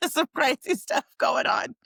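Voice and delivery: laughing while repeating it. Real, not acted. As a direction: say it through a laugh.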